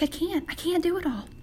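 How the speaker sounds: high-pitched retold frustration; frustrated. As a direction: act out giving up, overwhelmed. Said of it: "Frustrated, she's just like".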